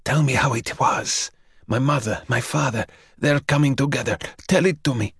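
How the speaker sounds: put-on the young man begging the vampire for his parents' story; eager plea. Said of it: "Dragozani was eager".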